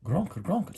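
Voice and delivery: high, loud squealing gronk; squealing pig grunt. A narrator's reading. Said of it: "squealed Don Credo".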